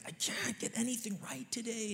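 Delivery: put-on high, soft, breathless upset child; mock upset child. Daughter sobbing to her parents, lightly imitated upset. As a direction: voice a worked-up little girl, lightly. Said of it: "hyperventilating almost".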